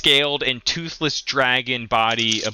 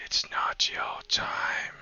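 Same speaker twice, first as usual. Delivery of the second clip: slow, very soft whisper; hushed otherworldly voice; ghostly whisper; 9 dB quieter, slower than usual. Acted. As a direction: whisper it slowly, like an apparition. GM voices apparition speaking to Ro.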